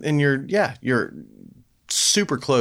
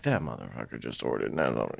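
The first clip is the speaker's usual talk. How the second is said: much lower and softer mutter under the breath; muttered aside; 9 dB quieter than usual. Retold or imitated. Joking about staff trash talk, low muttered aside. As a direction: grumble it behind the customer's back. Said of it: "they're like".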